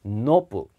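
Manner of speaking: "notebook" as two syllables "no-book", t unreleased; 영어식 발음. Shown as a demonstration. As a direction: say "notebook" like "nope-book", no vowel after the t. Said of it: "발음 공책". ("notebook"을 '노트북'이 아닌 '놋북'처럼 두 음절로)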